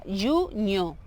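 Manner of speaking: JUNIO as "ju-nho", ending in ñ. Shown as a demonstration. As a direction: split "junio" in two, second part "ño". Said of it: "H es como una ñ".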